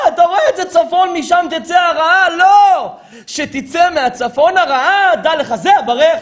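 high, loud, hammering questions; full-force preaching. Acted. Preacher explaining the crow to listeners, full-force preaching. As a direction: fire questions at the congregation at a shout.

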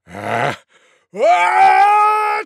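sharp intake, then long achoo; tiger's theatrical sneeze; huge mock sneeze. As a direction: wind up for an enormous fake sneeze. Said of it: "gave the loudest sneeze you could ever imagine".

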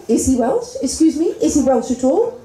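sceptical, rising challenge; incredulous questioning. Acted. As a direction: query it in mock disbelief, pitching up at the end.

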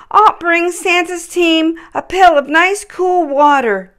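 a small reindeer gathering courage; young Rudolph. A narrator's reading. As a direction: Rudolph deciding to help. Rudolph's voice in story.

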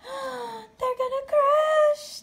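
high, loud, slow alarm; gasped alarm. Acted. Stellaluna seeing the birds fall, alarmed. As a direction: cry out in fear. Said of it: "gasped Stellaluna".

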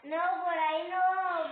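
drawn-out urgent no; urgent warning. Real, not acted. Warning teammate in game.

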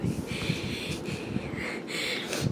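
breathy giggle under the breath; stifled little laugh; quiet laugh. Real, not acted. Laughs at own Star Wars pun.

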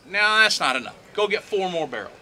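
loud, high, brushing it off; dismissive order. Acted. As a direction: wave them away and send them back. Elijah orders more water, acted.

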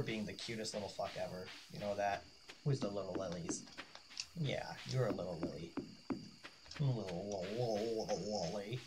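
soft cooing pet voice. Real, not acted. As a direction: coo softly at the cat. Baby talk to a pet.

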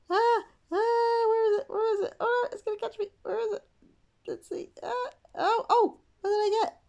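high, loud squealing mock panic; high, loud playful shrieks of pretend fright; excited squeals.

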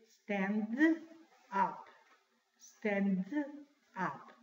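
"Stand" with released D, pause, then "up"; audible D, separated. Shown as a demonstration. Teacher drilling two words, "Stand" with clear D, "up" apart. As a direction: finish "Stand" on a clear D before "up". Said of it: "se escucha la pronunciación de la D".

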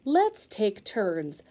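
ordinary delivery

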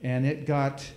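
ordinary delivery

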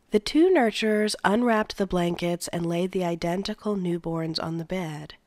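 usual speaking voice

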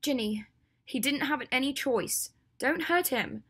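high, pressing, protective plea; urgent plea. Acted. Hermione steps in front of Draco, pleading urgently. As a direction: beg her to hold back.

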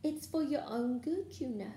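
high, saintly mock concern; Peeves. A narrator's reading. Poltergeist pretending to help the students, saintly voice. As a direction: coo it with false virtue. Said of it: "said Peeves in a saintly voice".

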